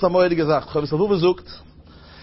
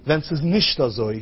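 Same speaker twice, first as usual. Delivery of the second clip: ordinary talk, nothing marked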